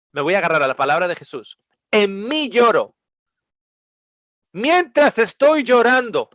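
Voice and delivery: high, pleading preacher's voice; impassioned preaching. Acted. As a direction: cry out the sorrow to the crowd. (predicación apasionada y suplicante)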